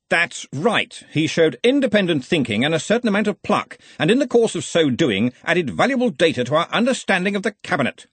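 head wizard laying down the law; blustering Arch-Chancellor. Acted. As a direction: chide your assistant with hearty authority. Ridcully defends the student.

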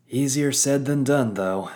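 weary, loud sighing captain. Acted. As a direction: sigh it out, tired of this. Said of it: "Captain Swift sighed".

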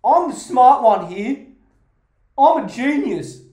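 loud, puffed-up sarcastic brag; mock boasting. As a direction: crow about being a genius, tongue in cheek. Sarcastic mock self-praise.

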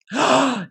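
a short barked bark like a dog; imitated dog bark. Waxer imitating the surprise dog bark mid-wax, mock bark.

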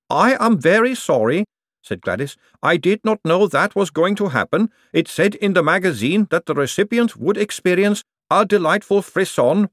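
high, earnest golem voice; apologetic golem. A narrator's reading. Gladys apologises for hurting Moist.

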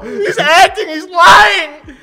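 crying-shout, high and loud; mock outraged shout. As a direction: yell it like betrayed by TV.

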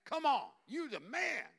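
loud, high, buddy-style cheering; Jesus cheering on Abel, loud and excited; excited praise. Acted. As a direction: hype him up like a proud friend. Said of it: "He's like".

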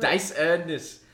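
loud, high, drawn-out German call; retold shout. Retelling shouting around Germany, loud mock shout. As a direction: holler it slowly to the street. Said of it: "I would just shout".

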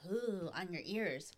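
very soft, slow cooing; soft ASMR coo. Retold or imitated. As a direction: murmur it close like ASMR. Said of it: "then it's like".

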